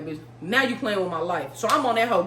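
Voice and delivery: normal talking voice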